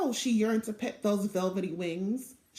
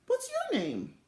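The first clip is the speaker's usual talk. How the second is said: high, light guide voice; guide asking; 11 semitones higher than usual. A narrator's reading. Tour guide asking the little girl her name, high and light. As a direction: ask kindly from above.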